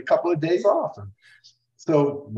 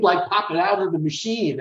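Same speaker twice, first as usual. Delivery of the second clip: normal talking voice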